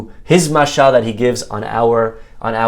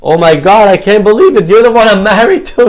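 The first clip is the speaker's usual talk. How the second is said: higher and louder, aghast; horrified shock; 7 semitones higher, 7 dB louder than usual. Acted. Husband sees his ugly wife for the first time, shocked cry. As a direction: cry out in horror at the sight.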